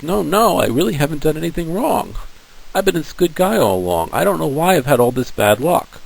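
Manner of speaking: high, pleading denial; a lightly voiced Job insisting he did nothing wrong; protesting Job. Job protesting to his friends, high and pleading.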